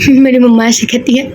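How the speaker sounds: laughing aside. Real, not acted.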